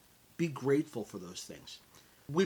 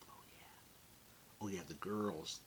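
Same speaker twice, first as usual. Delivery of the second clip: hushed, lower daydream murmur; dreamy whisper; 6 semitones lower, 14 dB quieter, much slower than usual. Pretend. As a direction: savor it under your breath. Daydreaming about long hair.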